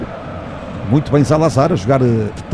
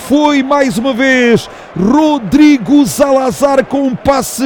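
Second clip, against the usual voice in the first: excited commentary; 6 semitones higher than usual. Real, not acted. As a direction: still riding the goal high. Goal aftermath commentary.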